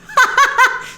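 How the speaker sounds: loud high burst of laughter. Real, not acted. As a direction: laugh out at the joke. Laughs at own joke.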